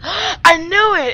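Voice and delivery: high, loud, slow shocked outburst; startled cry. Real, not acted. Player crying out when the monster appears. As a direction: cry it out as the scare hits. Jump scare after gasp.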